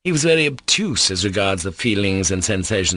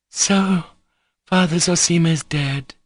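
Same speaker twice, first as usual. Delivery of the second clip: high, louder cry of shock; 9 semitones higher, 3 dB louder than usual. A narrator's reading. Grushenka hearing the elder has died, a high shocked cry. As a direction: gasp it out, stunned by the news. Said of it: "cried Grushenka".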